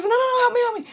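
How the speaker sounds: very high, loud panicked begging; panicked plea. Retold or imitated. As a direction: beg for help up high in panic. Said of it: "And she goes".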